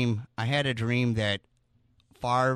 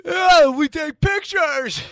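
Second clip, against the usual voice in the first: excited; 9 dB louder, slower than usual. Real, not acted. Joining the joke.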